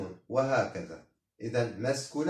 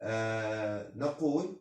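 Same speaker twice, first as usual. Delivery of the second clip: usual speaking voice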